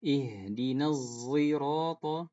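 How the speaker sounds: "Ihdinas zirata" slow, with z for sad and a dark o; slow zai recitation. Shown as a demonstration. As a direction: recite "zirata" slowly with a buzzing z. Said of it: "condong ke huruf zai".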